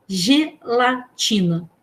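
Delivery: "gelatina" slowly split into separate syllables. Shown as a demonstration. Teacher showing mouth openings in a word, "gelatina" syllable by syllable. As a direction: say "gelatina" with a pause after each syllable. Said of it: "Quantas vezes você abre a boca".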